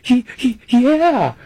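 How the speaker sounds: high giggly Cotton impression; excited Cotton. Storyteller mimicking Cotton agreeing to dinner, high and excited. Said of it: "He goes".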